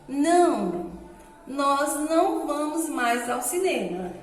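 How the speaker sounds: "Não," then a clear pause, slow after; pause at comma. Shown as a demonstration. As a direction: stop briefly after "Não". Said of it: "indicar uma pequena pausa".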